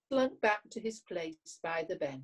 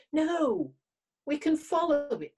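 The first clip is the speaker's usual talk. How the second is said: eager; 8 semitones higher, 6 dB louder than usual. Acted. Fox eager to follow star.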